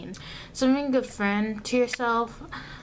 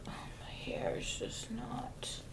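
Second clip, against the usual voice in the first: whispered under the breath; a private whisper to herself; whispered aside; 13 semitones lower, 15 dB quieter than usual. Real, not acted. Muttering aside about her hair.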